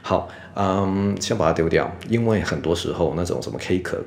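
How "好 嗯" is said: usual speaking voice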